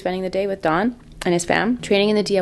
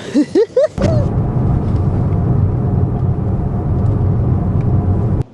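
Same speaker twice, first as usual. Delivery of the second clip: big laugh; 11 semitones higher, 6 dB louder than usual. Real, not acted. Laughing at the cold outside.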